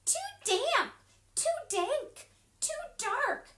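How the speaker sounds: high squeaky mouse complaint. Acted. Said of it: "Mouse squeaks".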